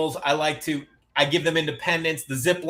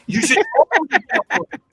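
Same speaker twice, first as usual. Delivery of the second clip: very high, louder exclamation; excited urging; 5 dB louder than usual. Retold or imitated. Telling the ex to get a Vespa, excited urging. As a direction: burst out with eager encouragement.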